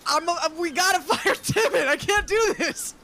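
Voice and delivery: high, anguished protest with a laugh; worked-up yelling. Acted. Captain cannot bear risking a father, high overwrought protest. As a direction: wail it, half laughing. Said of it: "Don't yell about it".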